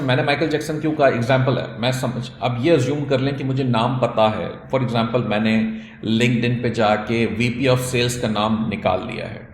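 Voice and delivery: normal talking voice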